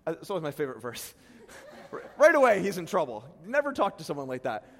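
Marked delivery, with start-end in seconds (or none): none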